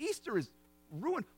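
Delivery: soft disbelieving echo, "ruined" rising; incredulous angel. Acted. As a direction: quietly question "Easter is ruined" in disbelief. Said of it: "the angel looks at you and says".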